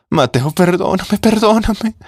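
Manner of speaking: beg, rising until it breaks; kneeling widow begging her stepson's forgiveness; desperate pleading. Acted.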